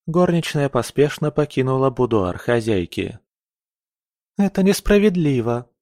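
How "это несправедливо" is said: high, plaintive complaint; understated crying, pitched up; tearful protest. A narrator's reading. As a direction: wail it lightly, as if crying. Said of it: "плакала Камилла".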